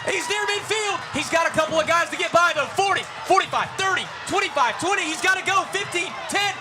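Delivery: high shouted rising play-by-play; touchdown call. Real, not acted. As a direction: build the call to a roaring touchdown. Touchdown run called live.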